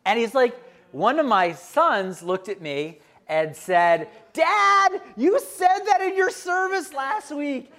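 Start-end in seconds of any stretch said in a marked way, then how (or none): 4.3-7.7 higher, mock child voice calling Dad out; son's voice. Son reminding dad of his sermon, higher mock kid voice. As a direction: play the son catching his father, a bit higher.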